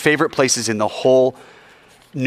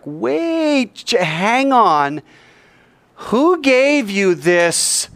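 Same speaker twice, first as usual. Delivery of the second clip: incredulous challenge; 7 semitones higher, much slower than usual. Retold or imitated. Priests challenging Jesus.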